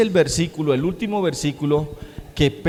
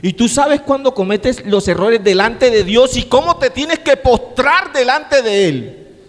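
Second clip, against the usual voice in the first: building higher into a fervent appeal; fervent exhortation; 9 semitones higher, 5 dB louder than usual. Acted. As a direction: press the congregation, rising to a climax. Exhorting congregation to repent. (exhortación ferviente que sube de tono)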